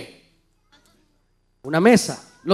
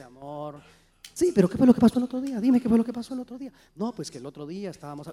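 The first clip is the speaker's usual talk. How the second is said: soft and much quieter, unhurried and gentle; calm hushed talk; 4 semitones lower, 8 dB quieter, faster than usual. Pretend. Couple talking over a problem at a restaurant, hushed and calm. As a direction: keep it down, like a private chat at a fine table. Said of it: "mira cómo se comunican, no levantan la voz".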